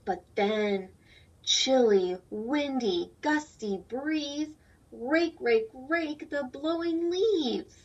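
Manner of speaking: slow, sing-song rhyming read-aloud; reading to children. A narrator's reading. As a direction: read the rhyme slowly for little ones. Reads picture book aloud.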